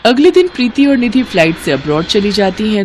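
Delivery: ordinary delivery, nothing marked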